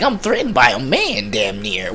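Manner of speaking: usual speaking voice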